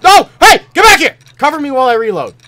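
loud high frantic shout; frantic yell. Real, not acted. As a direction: yell it at the teammate. Teammate leaves him exposed.

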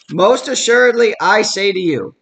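"Most assuredly" lifted and loud, demanding attention; emphatic. Shown as a demonstration. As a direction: make "Most assuredly" ring out like listen up. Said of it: "it's double emphatic".

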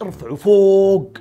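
slow, lofty exaggeration; hyping it up. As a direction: stretch the words out high to glorify it. Hyping up Game of Thrones.

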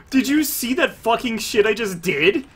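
high, thrilled exclamation; excited bragging. Real, not acted. Player asks teammates if they saw the play, excited. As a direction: demand they witness your great play.

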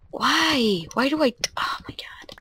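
unvoiced embarrassed whisper. Real, not acted. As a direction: whisper it to yourself. Embarrassed muttering.

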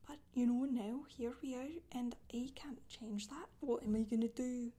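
ordinary talk, nothing marked

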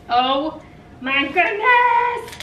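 slow, loud, rising squeal; amazed delight. Real, not acted. Opening a box full of goodies, amazed. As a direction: draw it out high in amazement.

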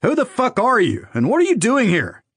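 high, annoyed challenge; irritated, high-pitched questioning; accusatory demand. A narrator's reading. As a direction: confront an intruder with sharp accusation. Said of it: "asked in an accusatory tone".

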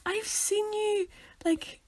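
slow hushed squeal of recognition; gushing fan. Pretend. Fan spotting the podcasters in the street, gushing. Voices a fan approaching them.

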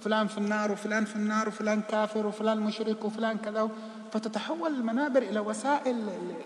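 ordinary delivery

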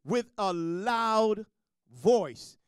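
"With a loud" drawn out slow, then "voice." punched loud; slow "With a loud", then a loud hit on "voice."; stress on loud voice. Shown as a demonstration. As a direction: stretch out "With a loud" and land "voice." hard. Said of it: "praising God with what?".

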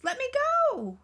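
high, loud cry for help; distressed yell. A narrator's reading. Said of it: "yelled the voice".

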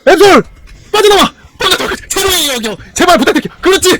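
high, loud panicked shouts; frantic yelling. Real, not acted. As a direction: scream at them to get away. Frantic escape attempt.

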